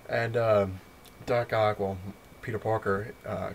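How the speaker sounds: ordinary talk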